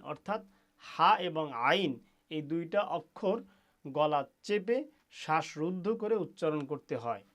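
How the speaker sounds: ordinary talk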